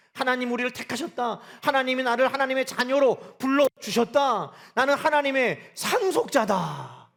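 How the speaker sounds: forceful sermon proclamation; preaching. Acted. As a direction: proclaim it to the congregation, building. Preacher declaring at full force.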